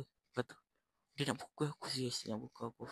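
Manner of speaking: ordinary talk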